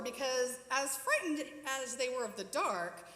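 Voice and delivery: ordinary delivery, nothing marked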